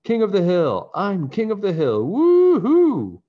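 high, loud whooping cry; triumphant yell. Acted. Boy snowboarding down the hill, whooping, high and loud. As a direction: crow it out like a show-off speeding downhill. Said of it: "someone yelled".

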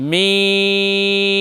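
মিম with a long held vowel, closing on the dry part of both lips; lips-together meem. Shown as a demonstration. Teacher shows learner the Arabic letter meem, long careful মিম. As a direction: stretch মিম out slowly, ending in a hummed m. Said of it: "দুই ঠোঁটের শুকনা স্থানে লাগিয়ে উচ্চারণ করতে হবে".

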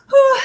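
sharp high exhale; breathless whew. Real, not acted. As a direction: blow out hard after the effort. Exhale after a hard set.

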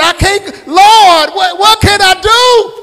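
high, loud panicked cry; a cornered mouse crying out; mock panicked shout. Mouse voiced, cornered by light.

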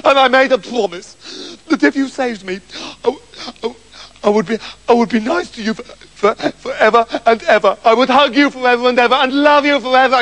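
high, stammering promises; hammed-up desperate devotion; tearful pleading. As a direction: beg and gabble as if still sobbing. Septimus begging through tears.

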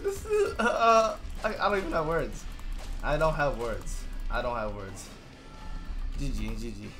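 normal talking voice

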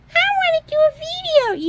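high, loud squeaky puppet; toy dragon voice. Pretend. Toy dragon asking for a video, high loud puppet voice. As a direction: squeak it eagerly as a pretend dragon. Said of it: "Hi, Dragon".

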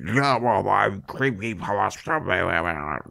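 slow, warbling monster voice; hammed-up spooky creature wobble; Creepypasta Man. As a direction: drag it out like a wobbly noodle creature. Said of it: "then he's like".

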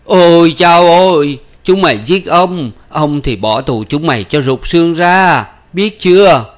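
high, loud, drawn-out wail of outrage; loud high-pitched tirade; furious screamed threat. Acted. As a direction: scream threats at the whole crowd. Woman screaming threats in crowd.

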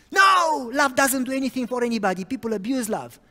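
loud, fast shouted retort; girl yelling that love does nothing; angry shout. Retold or imitated. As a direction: fire it back in anger.

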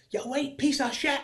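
high-pitched heckle at normal volume; half-held-back yell from the stands; mock crowd yell. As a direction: imitate a terrace heckler, pitched right up. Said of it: "If I stand out and yell in the crowd".

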